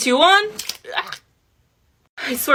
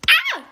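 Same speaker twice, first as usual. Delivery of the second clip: pained yelp. Real, not acted. Ripping latex off face.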